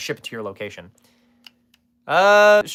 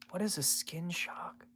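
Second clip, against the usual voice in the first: slow, hushed whisper; drawn-out voiceless question under the breath; baffled whisper; 4 semitones higher, 15 dB quieter, slower than usual. Real, not acted. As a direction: whisper it slowly, as if puzzling it out.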